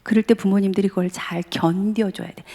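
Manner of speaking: ordinary talk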